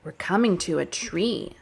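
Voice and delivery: rhythmic, sing-song story chant; reading to children. Acted. Teacher reading a nature rhyme to children, sing-song. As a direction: read it like a picture book for little kids.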